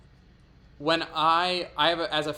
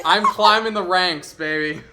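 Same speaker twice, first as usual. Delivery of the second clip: gloating; 5 semitones higher, 10 dB louder than usual. Real, not acted. Gloating about being favorite skull.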